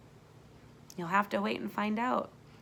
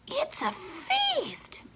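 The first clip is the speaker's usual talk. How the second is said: put-on high, slow, wide-eyed boy voice; awed young boy; much slower than usual. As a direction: marvel at it like a little kid. Dallas marvels at the table.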